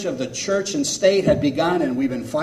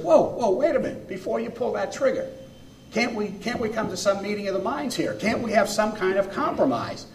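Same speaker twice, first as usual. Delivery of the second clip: higher-pitched, urgent appeal from a bear; pleading bear; 7 semitones higher than usual. Acted. Bear begs the hunter not to pull the trigger, higher-pitched pleading. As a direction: play a bear bargaining for its life. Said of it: "the bear spoke up".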